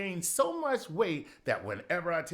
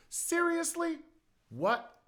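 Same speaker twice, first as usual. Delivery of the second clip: much higher disbelieving "Seriously?"; incredulous squeak; slower than usual. Pretend. Comic outraged at politicians, high squeak.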